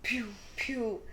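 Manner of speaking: small, light mouth-made pistol shots; playful "pew" sound effect; imitated gunshots. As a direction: mimic two little gunshots. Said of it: "all of a sudden you hear".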